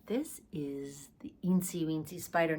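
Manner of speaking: hushed secret whisper; whispered like a little secret. Acted. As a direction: let them in on a secret. Secret song announcement to kids.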